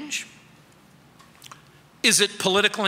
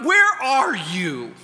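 high, loud cry of distress; 4 semitones higher, 4 dB louder, slower than usual. Acted. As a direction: call out desperately to Jesus. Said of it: "Have you ever cried out".

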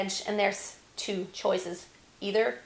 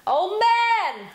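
high, loud drawn-out Oh man; frustrated yell; 9 semitones higher, 8 dB louder than usual. Shown as a demonstration. Coach showing the frustrated yell. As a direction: let the frustration burst out. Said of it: "as if you were just like".

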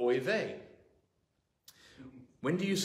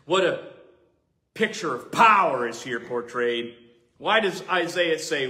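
ordinary delivery

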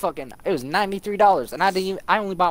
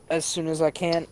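normal talking voice

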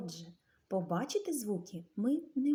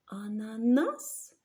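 Ананас? slow, with a questioning rise; asking intonation; 3 semitones higher, 3 dB louder, slower than usual. Shown as a demonstration. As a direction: ask Ананас? as a question, stretched. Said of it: "неначе ми запитуємо". (вчителька вимовляє Ананас? питально, з підйомом)

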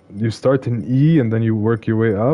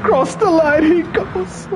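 shrieking jackpot winner; slower than usual. Real, not acted. Celebrating finish on replay.

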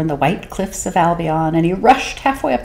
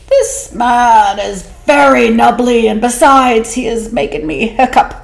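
uneasy whale; 6 semitones higher, 4 dB louder than usual. Acted. Whale complaining to the fish about the man. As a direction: grumble it like an upset whale. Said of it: "So he said to the 'stute fish".

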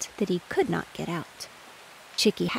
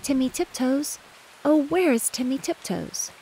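higher-pitched searching call; 6 semitones higher than usual. A narrator's reading. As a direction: call out for someone lost in the wood.

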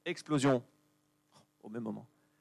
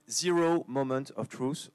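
slow, deliberate English pronunciation; showy English accent; slower than usual. Shown as a demonstration. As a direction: say "Zero Moment of Truth" slowly in an English accent. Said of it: "je fais bien l'accent anglais".

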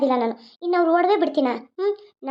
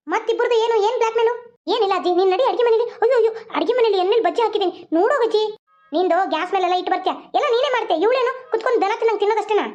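put-on higher, fussy old grandmother asking what the noise is; grandma hurrying in, nosy and scolding; 5 semitones higher than usual. Grandma asks the sisters about the shouting, high fussy old voice.

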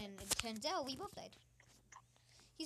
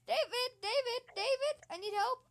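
high, loud frantic screams for help; panicked screaming; 11 semitones higher, 10 dB louder than usual. Real, not acted. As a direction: yell for help in terror. Caught in game, screaming.

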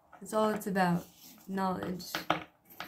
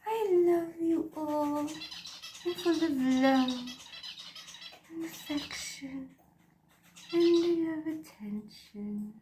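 higher, very slow cutesy pet voice; speaking for the cat; 6 semitones higher, slower than usual. Pretend. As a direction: voice the cat's sweet declaration of love.

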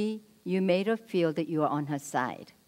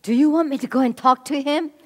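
eager offer; 7 semitones higher, 10 dB louder, faster than usual. Acted.

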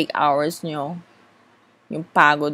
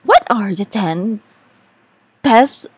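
higher, louder, slowed hype read; exaggerated YouTube countdown voice; clickbait video narrator; 4 semitones higher, 4 dB louder, slower than usual. Shown as a demonstration. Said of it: "you need to exaggerate things".

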